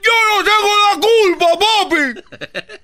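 hammed-up, piercingly high child's yell; screamed protest. Boy telling his dad it's not his fault, screamed protest. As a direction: plead innocence in a loud comic wail. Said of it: "¿por qué estás gritando?".

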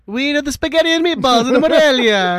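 put-on Italian accent. Mock Italian riff.

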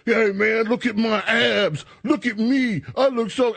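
high, preening show-off voice; lightly voiced vain gym bro; bragging gym guy. Guys in locker room bragging.

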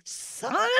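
high-pitched, drawn-in interest; mock thrilled reaction. Telling co-host her reaction to texts, mock thrilled. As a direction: play up being instantly sold.

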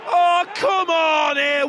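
loud, slowed groan of protest; frustrated shout. Real, not acted. Fan protesting a call against the team, loud frustrated shout. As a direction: drag the complaint out loud.

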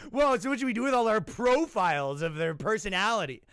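high put-on deflated voice; mock whiny profiler. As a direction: sound like a sidelined expert. Profilers feeling useless.

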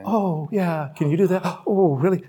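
soft, eager, gushing ladies' chatter with a gasp; a hammed-up impression of women chatting closely; ladies chatting. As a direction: lean in and gush like women catching up. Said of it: "like ladies have a conversation".

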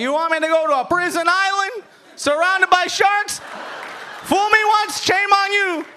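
high, shouting Cuban dad. Retold or imitated. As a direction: yell it like the stubborn Cuban father. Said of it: "He was like".

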